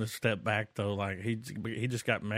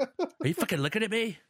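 high, put-on tough-guy challenge; mock Tarantino snarl; 11 semitones higher than usual. Imagined Tarantino confronting a fan in a high, put-on voice. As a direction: pretend to be the director picking a fight.

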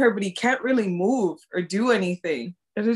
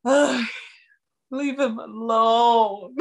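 drawn-out, whiny mock sob; hammed-up pained pleading; exasperated plea; 3 semitones higher, much slower than usual. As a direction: groan it out slowly, pleading for him. Pained reaction to family's harsh words.

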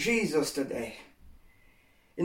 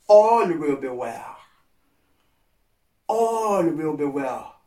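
slow comfort; 5 semitones lower, slower than usual. Real, not acted. Pastor slowly soothing anxious listeners, gentle reassurance. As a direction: lay each word down slowly to calm them.

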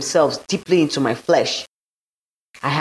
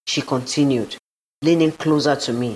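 usual speaking voice

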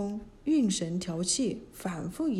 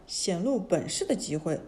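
ordinary delivery, nothing marked

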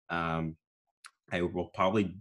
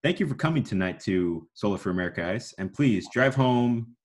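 PA sign-off; 3 semitones higher than usual. Shown as a demonstration. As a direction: address the whole arena warmly. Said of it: "it's like".

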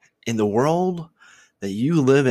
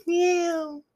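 high-pitched little kitten meow. Retold or imitated.

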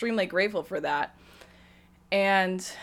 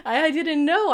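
laughing; 8 semitones higher, 5 dB louder than usual. Real, not acted. Laughs at her past self.

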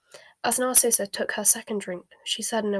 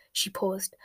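plain reading voice

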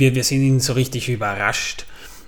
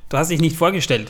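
usual speaking voice

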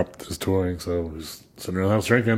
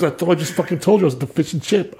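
higher, irritated mock Pete voice; exasperated Pete impression; 8 semitones higher than usual. Pete annoyed at Michael, exasperated and higher. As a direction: play Pete fed up at repeating himself. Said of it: "He goes".

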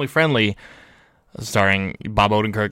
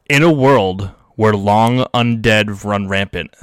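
horror trailer voice; 4 dB louder than usual. Pretend. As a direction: boom it out like a trailer announcer. Reading the story as a trailer.

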